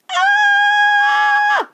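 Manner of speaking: loud, very high held shriek; excited scream. A narrator's reading. Veruca Salt screaming at something across the river, high shriek. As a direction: scream on one long high note. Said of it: "The screams came from Veruca Salt".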